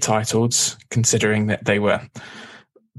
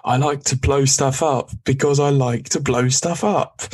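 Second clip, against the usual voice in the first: higher, hammed-up character voice; Wrecker impression; 4 semitones higher than usual. Podcaster quoting a favourite Wrecker line to co-host, amateur character impression. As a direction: play the big simple brute, pitched up from your own voice. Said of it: "when he said".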